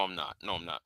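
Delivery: ordinary talk, nothing marked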